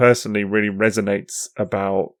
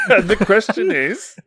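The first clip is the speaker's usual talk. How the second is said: echoes it through a laugh, slowly; slow amused echo with a laugh; 10 semitones higher, slower than usual. Real, not acted. Host laughingly echoes the question.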